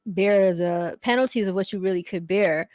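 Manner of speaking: ordinary talk, nothing marked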